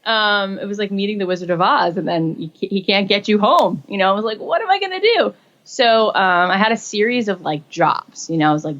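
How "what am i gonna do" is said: high, anxious wail of despair; retold panic at losing the deal; panicked. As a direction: cry it out like all hope is gone. Despair after being dropped.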